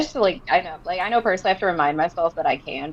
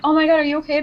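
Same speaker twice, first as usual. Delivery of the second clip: higher, worried ask; concerned; 7 semitones higher, 4 dB louder, much faster than usual. Retold or imitated. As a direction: check on a crying fan. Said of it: "And I'm like".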